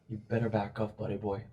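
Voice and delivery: a hint of a thug's warning; lightly voiced menacing thug. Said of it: "he says".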